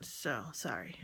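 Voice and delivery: whisper. Real, not acted. Apologizing quietly to the camera.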